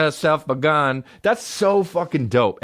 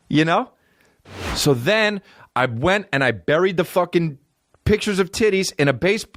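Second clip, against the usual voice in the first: ordinary talk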